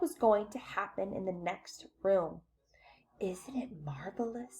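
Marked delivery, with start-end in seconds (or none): 3.2-4.6 hushed, voiceless whisper; awed whisper. A narrator's reading. Grandpa Joe to Charlie on the boat, whispering. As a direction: whisper it as a shared secret. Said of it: "said Grandpa Joe".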